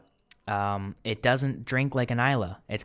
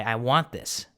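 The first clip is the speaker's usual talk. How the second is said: ordinary delivery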